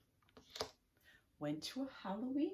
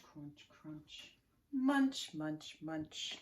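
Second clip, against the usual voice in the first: crunching sounds; 2 semitones lower, much slower than usual. Shown as a demonstration. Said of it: "Can you eat with me?".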